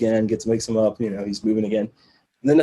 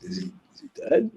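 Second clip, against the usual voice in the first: much higher, quick tentative question; imitated hesitant pilot; 12 semitones higher, 4 dB quieter, faster than usual. Pilot asking doctor about passenger, imitated hesitant query. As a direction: act out an uneasy pilot asking.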